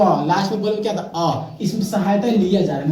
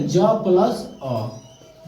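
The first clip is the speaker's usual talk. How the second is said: slow explanation; much slower than usual. Shown as a demonstration. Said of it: "आप लोग बोल के देखिए तो ज".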